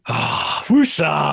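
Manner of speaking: mock calming mantra; venting release. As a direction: let the tension out in one breath. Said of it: "I feel better now I've vented that".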